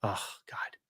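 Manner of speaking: breathy whispered oh God; exasperated under-breath whisper. Real, not acted. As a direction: whisper it in disbelief. Whispered reaction to reply.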